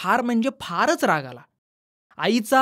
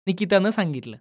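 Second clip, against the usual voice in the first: usual speaking voice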